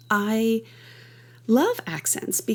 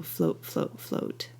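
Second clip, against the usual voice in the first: soft, fading echoes of a burst; low and light, dying to almost nothing; floating out; 4 semitones lower, 9 dB quieter, slower than usual. Shown as a demonstration. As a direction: let each word drift down and away. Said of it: "there's a sense of floating out".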